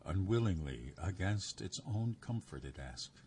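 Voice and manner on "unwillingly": ordinary delivery, nothing marked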